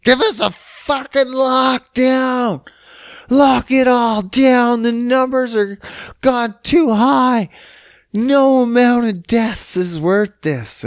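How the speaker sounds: high, slow mock protester voice; mocking lockdown fans. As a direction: ham up a whiny lockdown supporter, slowly.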